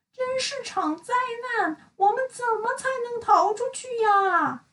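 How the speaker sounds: high, tearful wail; despairing character. Acted. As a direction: sob that they'll never escape. Trapped friends lamenting.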